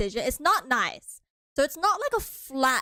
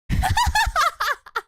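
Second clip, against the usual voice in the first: very high, loud laughter; big squealing laugh; real laughing fit; 8 dB louder than usual. Real, not acted. Laughs after begging viewer not to run.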